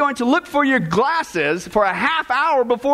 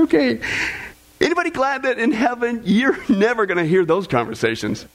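normal talking voice

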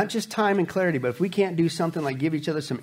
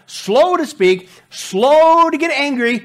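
slow and emphatic; 6 semitones higher, 6 dB louder, much slower than usual. Shown as a demonstration. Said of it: "quick to listen".

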